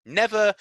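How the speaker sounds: high, loud NEVER; dramatic never. A narrator's reading. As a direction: punch Never as a twist. Dramatic narrator word.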